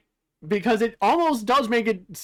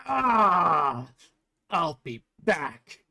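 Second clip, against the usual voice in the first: groaning villain; 4 semitones lower, much slower than usual. Acted. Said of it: "Naraku groans out".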